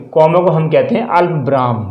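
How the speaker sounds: ordinary talk, nothing marked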